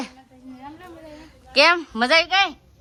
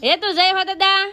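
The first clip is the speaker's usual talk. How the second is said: coaxing call; 7 semitones higher, 5 dB louder than usual. Real, not acted. Mother loudly prompts Hetu to say the greeting.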